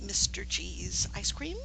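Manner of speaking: ordinary talk, nothing marked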